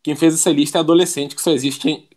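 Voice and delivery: usual speaking voice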